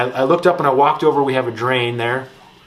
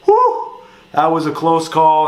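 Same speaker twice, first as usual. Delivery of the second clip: high relieved gasp, then a louder line; relieved whew; 4 semitones higher, 5 dB louder than usual. Retold or imitated. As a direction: sigh of relief after a near miss. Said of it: "And we're like".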